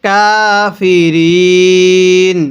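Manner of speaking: slow, drawn-out Quranic chant; measured tajweed recitation. Shown as a demonstration. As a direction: recite each word slowly and melodically.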